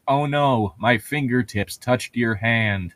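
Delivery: normal talking voice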